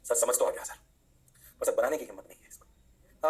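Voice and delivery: low-pitched mock student confession; lightly voiced doubtful pupil; hesitant student. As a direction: own up reluctantly, like a pupil to the teacher.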